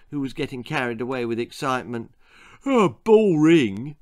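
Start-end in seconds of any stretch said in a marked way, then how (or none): 2.6-4.0 slow, louder bored yawn; bored boy yawning it out slowly. A narrator's reading. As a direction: drag it out like a yawn. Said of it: "yawned Arthur".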